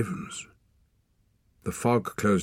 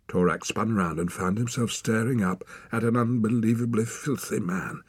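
ordinary delivery, nothing marked

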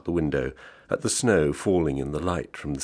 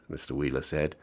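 ordinary delivery, nothing marked

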